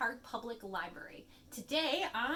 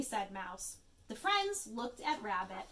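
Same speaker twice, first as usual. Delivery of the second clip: plain reading voice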